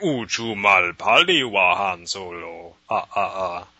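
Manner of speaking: slow, alien Huttese; Jabba the Hutt. Pretend. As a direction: growl slowly like Jabba. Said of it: "Star Wars".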